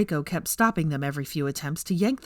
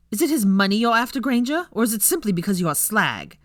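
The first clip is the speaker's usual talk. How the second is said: louder, higher furious accusation; angry; 5 semitones higher, 4 dB louder than usual. A narrator's reading. Millicent to Hermione, loud furious insult. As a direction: throw it at her in rage.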